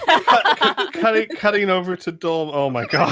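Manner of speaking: giggling through the words; trying to speak through laughter; laughing while talking. Real, not acted.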